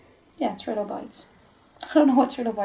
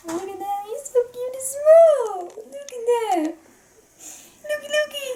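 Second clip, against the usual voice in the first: cutesy cooing; 13 semitones higher than usual. Real, not acted. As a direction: coo over something tiny and adorable. Cooing over the cute toy.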